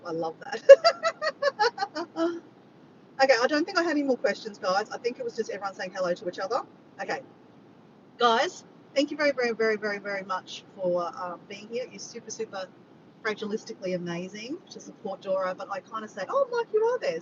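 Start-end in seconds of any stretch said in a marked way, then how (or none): 0.7-2.4 high, loud burst of laughter; real laugh. Real, not acted. Amused by question in chat. 16.3-17.2 high-pitched delighted discovery; mock surprise. Replays spotting Mike in chat.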